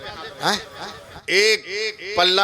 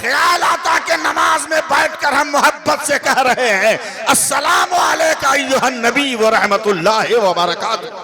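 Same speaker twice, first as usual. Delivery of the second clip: high, loud impassioned sermon cry; fiery preaching; 6 semitones higher, 3 dB louder than usual. Acted. Preacher rousing the crowd about prayer, shouting fervently. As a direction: build to a shouting climax.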